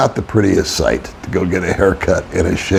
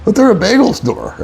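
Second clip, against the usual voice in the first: amazed; 12 semitones higher than usual. Retold or imitated. Wife's retold amazed reply.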